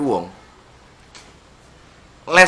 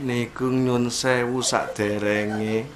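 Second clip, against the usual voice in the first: much softer, slow, overly refined; polite Solo speech; 6 semitones lower, 5 dB quieter than usual. Retold or imitated. Solo gentleman politely addressing a man in trouble. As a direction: murmur it like a courtly Solo gentleman. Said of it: "Solo person speaks softly".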